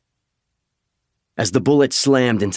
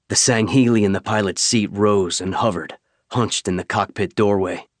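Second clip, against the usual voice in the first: usual speaking voice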